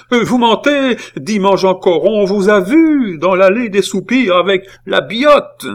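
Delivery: high, loud furious accusation; shrieked scolding in rising anger; mounting fury. A narrator's reading. Aunt confronting her nephew over the girl, furious. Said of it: "dont la colère s'augmentait".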